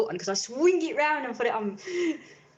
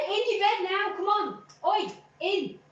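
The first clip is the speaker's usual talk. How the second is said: higher, firm dog command; 5 semitones higher than usual. Retold or imitated. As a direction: order the dog to his bed. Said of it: "I said".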